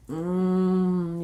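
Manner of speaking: long droning "mmm" as in labor; labor moan. Shown as a demonstration. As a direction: demonstrate a loud, steady moan. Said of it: "I'm doing that".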